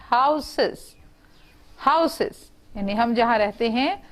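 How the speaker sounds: usual speaking voice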